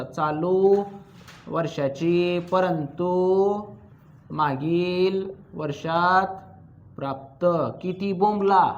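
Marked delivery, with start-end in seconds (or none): 0.0-7.4 word by word, very slow, with pauses between phrases; slow dictation. Shown as a demonstration. Teacher dictating a phrase for students to copy, slow and spaced. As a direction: dictate it slowly so the class can write. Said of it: "लिहा मग तेवढं शब्द लिहायचं आता".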